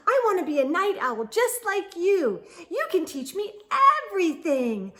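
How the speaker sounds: high, loud, rushing fan chatter; eager chirpy fan. Acted. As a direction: gush like an overeager little fan. Early Bird excitedly meets Owl.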